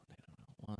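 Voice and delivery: whispered secret aside. Shown as a demonstration. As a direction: breathe the words out unvoiced.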